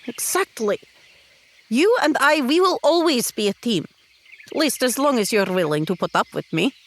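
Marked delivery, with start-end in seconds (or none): 1.7-3.9 eager affirmation. Acted. Talira affirms their bond.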